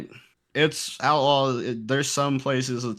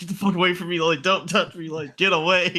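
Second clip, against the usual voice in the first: retold pained "leave me alone", pitched up; agitated rebuff; 6 semitones higher than usual. Shot player to the sorry kid, agitated rebuff. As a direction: re-enact waving someone off, higher voice.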